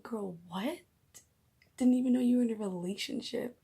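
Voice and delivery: reenacted shocked delight, rising high and loud; freaking out. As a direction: gush in disbelief at a friend's surprise news. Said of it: "freaking out at work".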